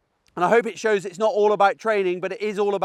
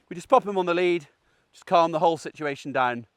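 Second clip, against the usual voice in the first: usual speaking voice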